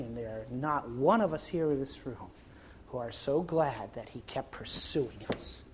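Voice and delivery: tender hush. Acted. Hushed tender preaching close.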